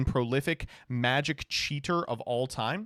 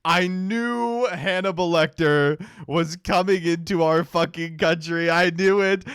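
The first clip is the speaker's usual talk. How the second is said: mock vindicated shout; 3 semitones higher, 6 dB louder, slower than usual. Gleeful mock outrage at rally clip.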